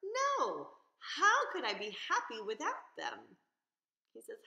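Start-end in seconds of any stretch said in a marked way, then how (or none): 0.0-3.4 much higher, loud refusal; shocked fish shouting no; appalled outcry. Acted. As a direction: cry out in horror.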